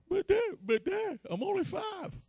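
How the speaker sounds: put-on high, pleading kid voice; little boy protest. Five-year-old son protesting his dad's fighting advice, high and whiny. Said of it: "child protesting to dad".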